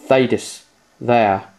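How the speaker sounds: normal talking voice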